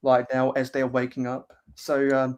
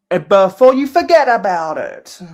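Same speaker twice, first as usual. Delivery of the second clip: put-on lazy New Jersey accent, higher and louder; 5 semitones higher, 8 dB louder than usual. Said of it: "That's my very lazy New Jersey accent".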